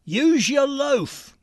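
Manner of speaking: high, drawn-out Cockney voice; Cockney accent. Acted. Cockney telling someone to think harder, in Cockney accent. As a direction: put on a slow, high-pitched Cockney. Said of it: "When a Cockney wants you to think a bit harder, he says".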